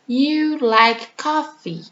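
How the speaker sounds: slow, plain statement tune; teacher modelling a statement. Shown as a demonstration. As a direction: read the statement slowly. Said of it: "a forma afirmativa".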